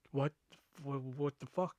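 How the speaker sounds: very soft, halting disbelief; retold dumbfounded murmur; stunned muttering. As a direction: trail into a speechless, hushed mutter. Retells stunned reaction to the mess.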